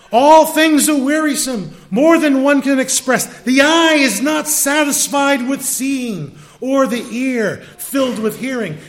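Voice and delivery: high, loud preacher reading a verse; emphatic scripture reading. Acted. Teacher quoting verse eight to the congregation, emphatic and loud. Reads scripture aloud with force.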